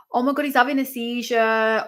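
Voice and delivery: high, worried alarm. Real, not acted. Viewer seeing the downed fighter shake, alarmed and high. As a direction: rush it out in alarm.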